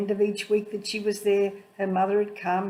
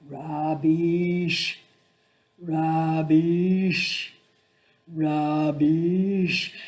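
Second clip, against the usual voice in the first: calling out; 3 semitones lower, 4 dB louder, much slower than usual. Retold or imitated. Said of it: "I would call out".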